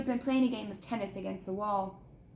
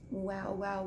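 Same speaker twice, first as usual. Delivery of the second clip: soft, lower, menacing; whispering; 3 semitones lower, 5 dB quieter than usual. Acted. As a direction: hiss it softly. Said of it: "he whispered".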